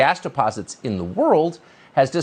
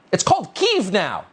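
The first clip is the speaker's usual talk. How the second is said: higher-pitched mocking slogan; mock party message; 6 semitones higher than usual. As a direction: mimic the Republicans' line in a raised, mocking pitch. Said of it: "That's the Republican message".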